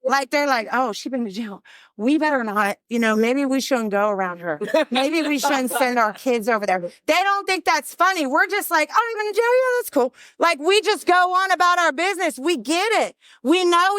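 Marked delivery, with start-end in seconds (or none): 0.7-6.8 lower, wary, judging tone; mock the uneasy neighbours who keep their kids away; disapproving. Normal people judging her jail past. 8.9-10.1 higher, casual and easygoing; breezy acceptance. Retold or imitated. Fellow addicts shrug off jail.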